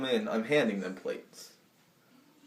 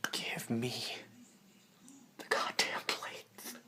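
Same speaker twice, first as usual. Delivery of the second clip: slow, menacing whisper; hushed, drawn-out acted threat; close-up threat; 9 dB quieter, slower than usual. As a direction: whisper it word by word, seething in mock menace. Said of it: "I get closer, I'm like this".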